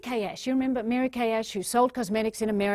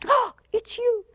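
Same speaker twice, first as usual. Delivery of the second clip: high, slow delighted greeting; light up at someone you love; 11 semitones higher, slower than usual. Retold or imitated. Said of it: "it's like".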